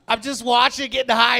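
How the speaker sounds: ordinary delivery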